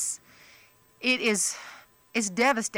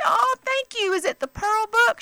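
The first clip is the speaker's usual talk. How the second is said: much higher, delighted and flattered. Retold or imitated. Comedian thanking a fan, much higher and delighted. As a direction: squeal a pleased thank-you.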